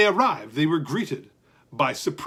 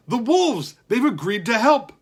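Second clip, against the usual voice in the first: shouting; 6 semitones higher, 4 dB louder than usual. Acted. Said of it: "Slip shouted".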